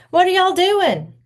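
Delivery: high, loud call from behind. A narrator's reading. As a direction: call out to the friends behind them. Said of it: "Clemmy's voice called from behind".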